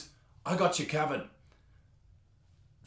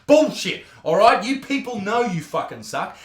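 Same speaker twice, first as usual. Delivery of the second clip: usual speaking voice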